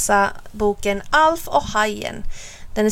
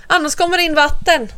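put-on higher, insistent child; urgent warning; 6 semitones higher, 3 dB louder than usual. A narrator's reading. Alf warns Bo water will come in, urgent warning. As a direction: warn with mild alarm.

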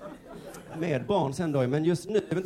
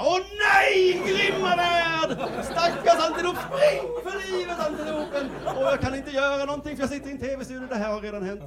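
much higher, loud anguished wail; overexcited commentator; 13 semitones higher, 3 dB louder than usual. Retold or imitated. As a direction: cry out for the antelope like it's a tragedy. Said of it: "vilja att han bara". (högt, överspänt klagande kommentatorsrop)

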